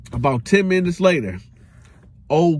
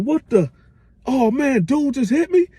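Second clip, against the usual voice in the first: rising higher, disbelieving; shocked; 8 semitones higher than usual. Retold or imitated. Roach shocked after a pistol blow to the head. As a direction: react like you just got hit.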